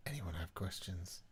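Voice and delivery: hushed whisper of the question. Pretend. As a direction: breathe it out in a whisper.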